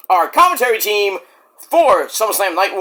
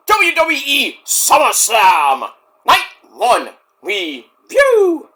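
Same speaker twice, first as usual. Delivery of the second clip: big show announcer; 3 semitones higher, much slower than usual. Pretend. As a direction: announce the show slowly and grandly. Announces the show's title.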